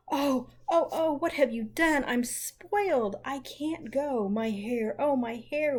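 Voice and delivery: high, loud, drawn-out wail of dismay. Acted. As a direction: wail over ruined hair, dragging the words. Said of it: "wailed Meg".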